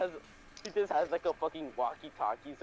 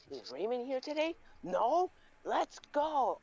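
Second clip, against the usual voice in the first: high voice breaking into glee; hammed-up joy, pitched up; delighted teacher; 9 semitones higher, slower than usual. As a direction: celebrate as a teacher finding the pupil absent. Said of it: "she would just be like".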